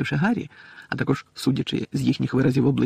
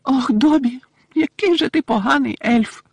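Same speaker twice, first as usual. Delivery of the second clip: high, slow wail; tearful little elf lamenting; moaning elf; 12 semitones higher, 6 dB louder, slower than usual. A narrator's reading. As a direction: groan the reproach in a high elf voice. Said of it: "простогнала Вінкі".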